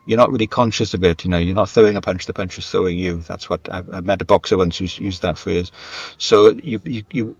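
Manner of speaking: ordinary talk, nothing marked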